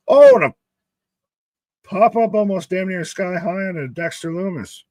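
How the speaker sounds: sudden high, loud oh on the big move; excited call. Real, not acted. Streamer calling a sky-high pop-up, excited. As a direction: burst out at the pop-up.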